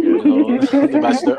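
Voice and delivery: high, loud laugh through the words. Real, not acted. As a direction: laugh as you answer. Joke answer, laughing.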